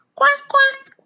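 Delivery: high loud quacking; duck quack. Pretend. Teacher imitating duck.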